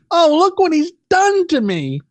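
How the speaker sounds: high mocking whiny complaint; whiny woman mock. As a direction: mimic her little victim whine. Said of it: "she made some sort of little".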